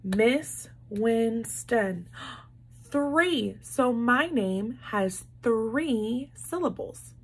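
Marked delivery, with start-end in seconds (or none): 0.0-2.1 slow, each syllable of Winston beaten out; syllable clapping. Shown as a demonstration. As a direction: say it clap by clap. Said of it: "Let's count. Ready?".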